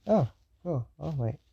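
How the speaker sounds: a touch of excitement, not too much; mildly excited. Acted. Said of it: "Not really too excited".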